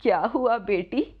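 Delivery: lightly voiced old woman, questioning; a hint of an elderly mother figure. Disguised goddess asks worried Radha what happened, lightly voiced old woman. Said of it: "एक बूढ़ी औरत का रूप बदलकर".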